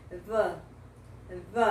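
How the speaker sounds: 'V' as 'thuh', voiced th, not D; 'V' as a low, short 'thuh'; closed 'the'. Shown as a demonstration. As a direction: say 'V' with tongue between teeth and a schwa. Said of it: "Mas é assim".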